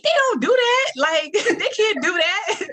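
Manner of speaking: high and laughing through the words; giggling at how silly it was. Real, not acted. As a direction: laugh it off in disbelief. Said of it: "I laugh about it now".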